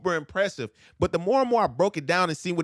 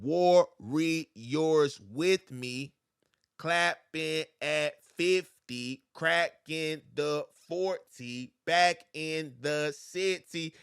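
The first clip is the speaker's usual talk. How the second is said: slow breakdown; much slower than usual. Shown as a demonstration. Teacher slowing the bars so students hear syllables. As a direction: stretch every word out slowly. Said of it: "that's a good one".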